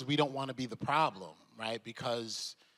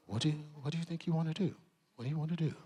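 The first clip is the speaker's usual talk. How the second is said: softer, fast, smooth questioning; soft Irish lilt; 6 dB quieter, much faster than usual. Retold or imitated. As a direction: nudge the young actor for his idea. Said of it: "soft, deep Irish lilt".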